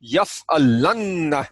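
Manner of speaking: "yaf'alanna" slowly, holding the double n; heavy doubled noon. Shown as a demonstration. Teacher demonstrates the shadda, "yaf'alanna" with a heavy, lengthened n. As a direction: weigh down the "nn" in "yaf'alanna". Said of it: "notice that it's heavier".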